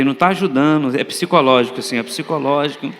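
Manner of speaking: low-pitched mock self-soothing; strained self-reassurance. Sick preacher telling himself it's in his head, low and uneasy. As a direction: talk yourself down, deep and tense.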